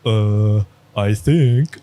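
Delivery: a hint of a hesitant student, trailing; lightly voiced unsure student, "I think" drawn out as a guess. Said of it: "And his student goes".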